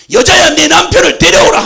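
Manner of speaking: loud, high commanding shout; Jesus shouting his command to the woman. Acted. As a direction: cry the command out to her.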